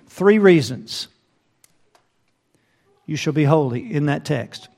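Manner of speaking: ordinary talk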